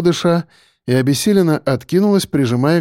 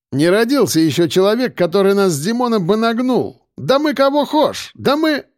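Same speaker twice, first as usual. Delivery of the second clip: cocky boasting; 7 semitones higher than usual. A narrator's reading. Driver bragging nobody can beat them, cocky. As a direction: boast climbing ever higher in pitch.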